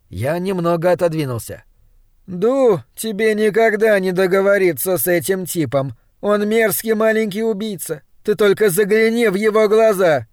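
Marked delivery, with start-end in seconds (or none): 2.3-10.3 higher-pitched, accusing woman's voice; hostile woman. Acted. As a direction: warn him against this man, high and insistent. (возмущённый женский голос, повыше)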